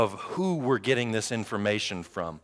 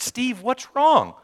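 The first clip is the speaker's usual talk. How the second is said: put-on girl asking; 13 semitones higher, 4 dB louder, much slower than usual. Girl from high school asks.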